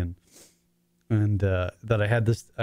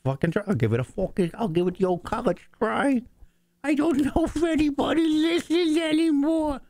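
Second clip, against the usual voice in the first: self-mocking quote pitched higher and higher; 14 semitones higher than usual. Self-mocking quote climbing to a squeal.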